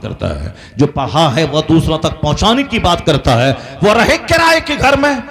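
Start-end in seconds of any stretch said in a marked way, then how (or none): 3.8-5.2 high, ringing sermon cadence; fervent preaching. Real, not acted. Preacher honouring the poor but learned cleric, fervent. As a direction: build phrase on phrase at high pitch.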